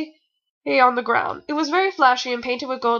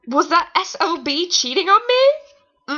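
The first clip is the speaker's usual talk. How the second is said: mock jealous heroine; 5 semitones higher, slower than usual. Dramatic fanfic reading.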